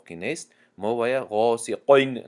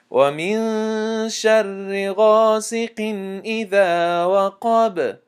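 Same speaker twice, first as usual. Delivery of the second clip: slow melodic tajweed chant, drawn out; Quran recitation; 8 semitones higher, 5 dB louder, much slower than usual. Shown as a demonstration. Teacher recites al-Falaq to students in slow tajweed chant. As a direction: recite each verse slowly, measured and melodic.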